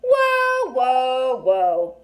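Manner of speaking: loud falling whoa from the back; boy cutting in loudly; calling out. A narrator's reading. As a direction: stop them with a loud call. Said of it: "Connor said from the back".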